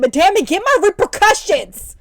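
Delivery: loud, high, drawn-out outburst; exasperated demand. Retold or imitated. Sister wanting her brother punished by tired mom, exasperated yell.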